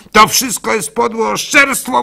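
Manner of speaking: high, loud indignant cry; shouting denial. Acted. Wife denying before the rabbinical court, loud indignant shout. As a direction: fling the denial loudly. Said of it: "wołała".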